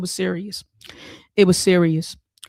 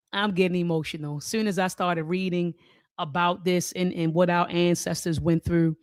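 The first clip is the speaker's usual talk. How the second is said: usual speaking voice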